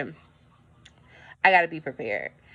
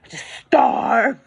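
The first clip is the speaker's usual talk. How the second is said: mock wail; 5 semitones higher, 6 dB louder, slower than usual. Mock wail over budget.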